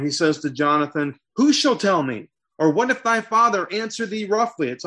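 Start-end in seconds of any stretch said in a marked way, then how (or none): 1.4-4.7 high-pitched, agitated questioning; worked up. Acted. David doubts Jonathan can warn him, worked up. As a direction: demand an answer, pitch climbing. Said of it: "David is so worked up at this point".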